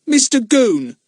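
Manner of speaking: high, loud defiant answer; boy answering back. A narrator's reading. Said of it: "said Pip defiantly".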